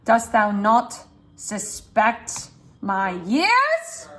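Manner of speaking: slow, weighty, rising on years; drawn-out indignant question; slow stressed read. Shown as a demonstration. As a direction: stretch it out and lift years. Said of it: "pitch there".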